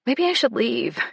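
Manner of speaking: ordinary delivery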